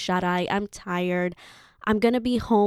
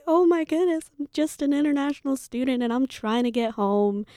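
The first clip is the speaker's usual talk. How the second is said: high, put-on tearful pleading; milk it like a helpless crying student; mock bawling to win a seat on the flight; fake sobbing plea; 6 semitones higher than usual. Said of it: "I bawled my eyes out".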